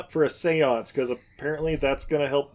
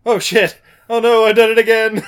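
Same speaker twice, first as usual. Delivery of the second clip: rising alarm, louder; panic, getting louder; panicked; 7 semitones higher, 8 dB louder than usual. Retold or imitated. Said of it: "he's like".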